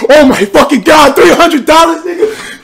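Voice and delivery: loud, high, stunned yelling; excited shout. Real, not acted. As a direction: yell it out, overwhelmed by the gift. Huge donation arrives live.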